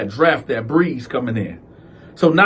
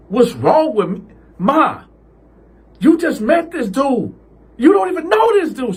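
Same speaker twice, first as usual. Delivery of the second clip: high rising outrage; upset; 10 semitones higher than usual. Retold or imitated. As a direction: climb higher in outrage. Cal arguing with mom.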